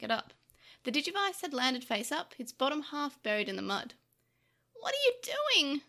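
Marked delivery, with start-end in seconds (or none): 4.7-5.8 high, drawn-out upset cry; distressed cry. A narrator's reading. Tokomon, tearful, questions Takeru's throw, crying out. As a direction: cry out in disbelief. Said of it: "Tokomon cried out to Takeru".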